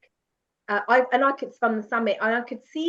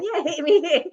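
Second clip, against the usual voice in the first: laughing through a dare; laughing defiance; 6 semitones higher, 6 dB louder than usual. Retold or imitated. Daring her porter to hit her, laughing. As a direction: dare him, giggling.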